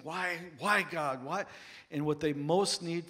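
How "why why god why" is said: pleading why. Retold or imitated. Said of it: "they're saying".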